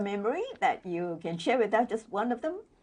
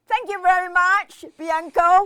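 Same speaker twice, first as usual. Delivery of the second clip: excited thanks; 9 semitones higher, 6 dB louder than usual. Real, not acted. Host thanks the performer.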